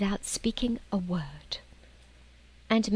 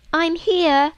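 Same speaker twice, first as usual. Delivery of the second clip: loud, high call to the others; shouting; 10 semitones higher, 8 dB louder than usual. Acted. Lucy shouting to the others in the passage. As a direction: call out so they hear you. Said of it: "she shouted".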